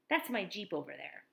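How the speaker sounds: normal talking voice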